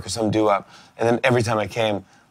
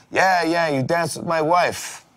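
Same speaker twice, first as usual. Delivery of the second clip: higher and slow, each word laid out pointedly; mock menacing owner; 7 semitones higher, slower than usual. Restaurant owner accusing the guest over coffee, mock menacing and slow. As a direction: drag out the accusation like a mock mob boss.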